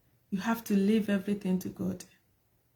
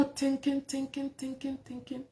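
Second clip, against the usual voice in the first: trailing off softer and softer; fading away; 2 semitones higher, 8 dB quieter than usual. Real, not acted. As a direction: let each thinking fade. Repeating word, fading away.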